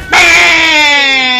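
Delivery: loud drawn-out exclamation; a long hype cry; excited yell. Real, not acted. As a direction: let it ring out, hyped. Excited yell mid fight.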